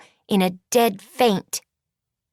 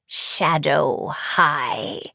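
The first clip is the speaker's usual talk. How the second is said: low, very slow, disdainful sorceress; disgusted; 5 semitones lower, much slower than usual. A narrator's reading. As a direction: draw it out low with a curled lip. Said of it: "her lip curled with disgust".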